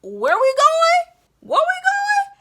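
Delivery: very high, loud, pumped-up call; rally a crying toddler with energy; hype call. Retold or imitated. Father rallying his crying toddler, loud high hype call. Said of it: "And I was like".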